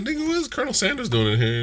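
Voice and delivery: high-pitched disbelief; mock incredulous. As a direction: squeak out the question in disbelief. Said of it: "I was like".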